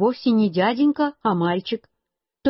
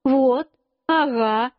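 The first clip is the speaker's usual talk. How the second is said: drawn-out, higher identifying call; slow, stretched-out pointing words; 4 semitones higher, much slower than usual. A narrator's reading. Boy points out Клавдия to grandmother, demonstrative. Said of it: "Я сказал".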